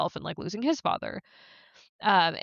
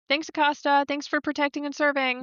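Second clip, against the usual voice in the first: higher-pitched mock gratitude; sarcastic thanks; 7 semitones higher than usual. Real, not acted. Host blaming a character for the lost ambulance, sarcastic. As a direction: thank her while meaning the opposite.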